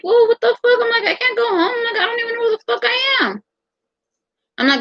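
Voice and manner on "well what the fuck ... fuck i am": high, near-crying wail of disbelief; incredulous cry, voice cracking up high; upset outburst. Retold or imitated. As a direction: blurt it out high, close to tears. Upset at being freed.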